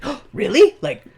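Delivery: high, loud fake delight; mock surprise. Chow fakes joy at Mei Li's news, high and loud. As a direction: squeal it out in forced cheer.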